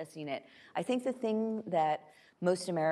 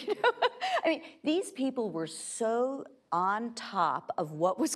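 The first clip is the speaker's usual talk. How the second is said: normal talking voice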